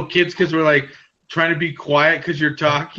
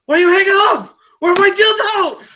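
much higher, louder squawk; mock outraged caller; 5 dB louder than usual. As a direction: shriek it like the angry customer. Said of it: "call them and be like".

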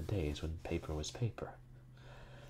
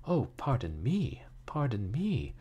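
raised, exaggerated apology; pleading; 6 semitones higher, 4 dB louder, slower than usual. A narrator's reading. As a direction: plead pardon in a higher voice. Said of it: "pleaded Miss Fuller hastily".

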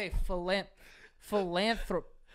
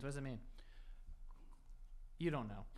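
ordinary talk

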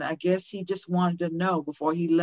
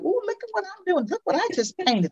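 put-on much higher, fast, eager kid voice; proud little child; 12 semitones higher than usual. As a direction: play the child showing off a new find.

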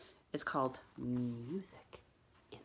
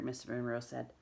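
ordinary delivery

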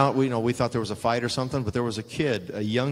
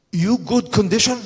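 higher, louder mock Japanese-accented English; broken English impression; 10 semitones higher, 8 dB louder than usual. Muta asks Sting before the match, in mock broken English. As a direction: imitate Muta's broken English, hammed up. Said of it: "he always did the same thing".

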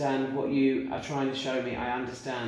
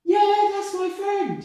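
much higher and louder, slow and stretched; spirit's voice; 12 dB louder, much slower than usual. Retold or imitated. Medium relaying the spirit's answer, very high and slow. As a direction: sing it out like the spirit.

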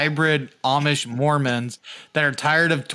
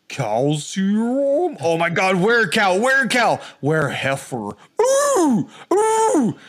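wailing high cow cries at the end; tripping moo; 4 semitones higher, much slower than usual. Pretend. Drugged tourist wailing like a cow on acid water.

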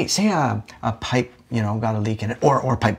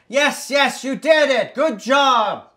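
shouting; 6 semitones higher than usual. Acted. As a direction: yell it down to the basement. Shouting down to the basement.

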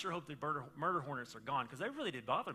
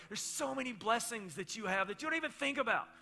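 grandpa-like; 6 semitones higher, 5 dB louder than usual. Pretend. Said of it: "grandpa-like".

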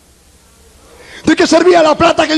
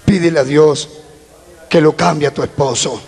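gentle sister; 10 semitones lower than usual. Retold or imitated. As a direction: lower and hush it, a kindly sister counseling. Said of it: "la hermanita le dijo".

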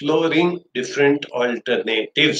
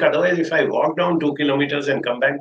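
ordinary delivery

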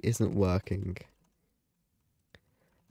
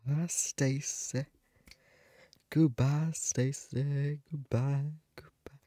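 gloating farewell; 4 semitones higher, slower than usual. Real, not acted. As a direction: coo a mocking farewell, fading away at the end. Gloats as Stacey is voted out.

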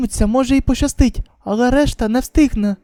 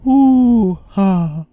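plain reading voice

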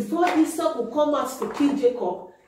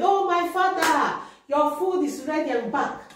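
higher, brighter voice as Esau calling his father; eager son; 7 semitones higher than usual. Acted. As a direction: play Esau bringing the food. Esau voiced in Bible story.

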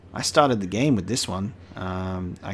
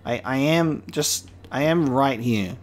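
slow, deliberate words to someone who can't see you; slow and clear. Real, not acted. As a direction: spell it out slowly, word by word. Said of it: "just in case you were looking upwards".

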